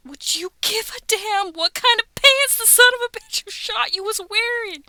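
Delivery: put-on impassioned courtroom-movie girlfriend. Lady in My Cousin Vinny making her deer argument, hammed-up and indignant. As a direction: start the deer scene gently, then burst out, loud and indignant. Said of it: "She does it way better than I do".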